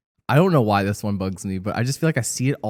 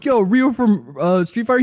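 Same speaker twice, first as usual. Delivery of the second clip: mocking voice; 9 semitones higher, 3 dB louder than usual. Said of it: "They're like".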